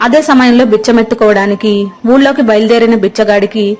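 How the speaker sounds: ordinary delivery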